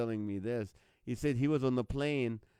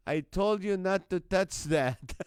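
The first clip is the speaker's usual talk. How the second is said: raised, louder joking reprimand; playful telling-off, pitched up and loud; mock scolding; 5 semitones higher, 5 dB louder than usual. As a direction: scold the co-pilot in jest. Acted pilot scolding someone in cockpit.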